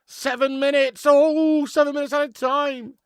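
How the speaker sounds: very loud, high-pitched drawn-out cry; excited shouting. Real, not acted. Streamer calling out the minutes left, excited high shout. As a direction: holler it out high and long.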